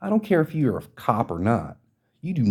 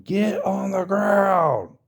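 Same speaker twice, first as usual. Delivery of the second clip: high, slow, bellowed police command; drawn-out shouted arrest command, high and strained; cop screaming; 11 semitones higher, much slower than usual. Retold or imitated. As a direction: scream the order like a cop with a rifle aimed. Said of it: "screams at the top of his lung".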